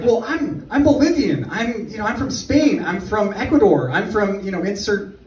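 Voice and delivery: mock outrage, pitched well up; hammed-up indignant students; outraged students. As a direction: protest indignantly in a high voice. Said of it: "They're like".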